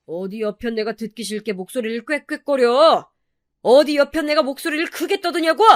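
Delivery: indignant husband snapping, rising high at the end; angry scolding husband. A narrator's reading. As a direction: scold her, pitch climbing into an outraged question. Husband's scolding quoted in the story.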